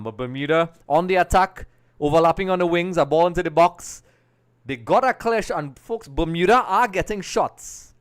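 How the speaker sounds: loud, high, urgent match commentary; live play-by-play. Real, not acted. Commentator tells viewers Bermuda are attacking, urgent play-by-play. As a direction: drive the call along as the attack builds.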